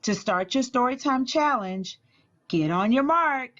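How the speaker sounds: ordinary delivery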